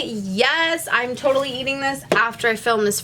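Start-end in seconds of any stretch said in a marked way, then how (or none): none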